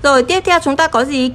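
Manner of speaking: normal talking voice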